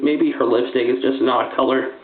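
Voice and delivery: normal talking voice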